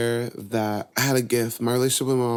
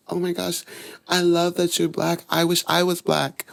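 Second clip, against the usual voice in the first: higher, gushing friend voice; excited little friend; 7 semitones higher than usual. Retold or imitated. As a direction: gush like a delighted classmate. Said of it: "And she would be like".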